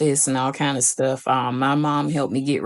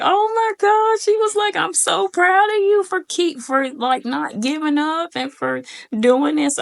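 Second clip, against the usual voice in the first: much higher, gushing proud mother; proud mom. Retold or imitated. Mom praising her daughter for passing, high and gushing. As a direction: gush with pride like a mom.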